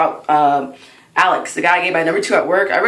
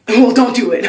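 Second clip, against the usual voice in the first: louder, higher comic warning; mock warning; 5 semitones higher, 7 dB louder than usual. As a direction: throw it out as a loud punchline. Comedian's retold punchline to the crowd.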